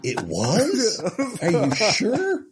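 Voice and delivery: high, slow, incredulous question; disbelieving. Retold or imitated. Voice actor doubting the client's praise, slow and incredulous. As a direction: stretch it out in disbelief. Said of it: "That was brilliant".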